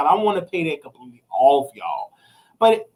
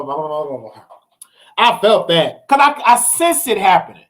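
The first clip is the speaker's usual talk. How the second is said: ordinary talk, nothing marked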